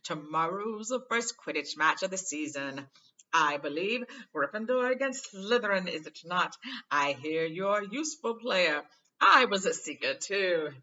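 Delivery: loud, slowish showman bragging; boastful Lockhart. Acted. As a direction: preen about his past.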